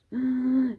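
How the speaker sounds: sharp retold gasp for breath; a sudden panicked gasp, acted out. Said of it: "Eu comecei literalmente a ficar".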